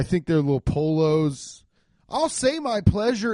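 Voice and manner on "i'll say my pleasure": high, put-on customer-service voice; mock a fast-food greeting up high; chirpy service worker. Parodies fast-food worker's stock phrase.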